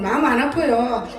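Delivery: normal talking voice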